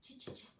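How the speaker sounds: very soft ch, ch, ch; ch bursts. Shown as a demonstration. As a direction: whisper the ch three times. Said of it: "For chin".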